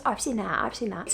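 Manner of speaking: soft, quiet mock New Zealand accent; hint of a New Zealand lilt, murmured; bad New Zealand accent. Quoting the singer softly in a faked New Zealand accent. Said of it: "not a good fucking accent".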